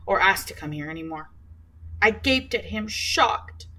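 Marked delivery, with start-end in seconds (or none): none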